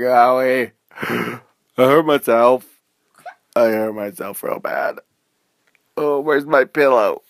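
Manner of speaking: loud, slow, whimpering complaint; whiny hurt. Acted. Lexi with a goose egg, whining loud and slow. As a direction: whine like a bruised little kid. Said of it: "she's like".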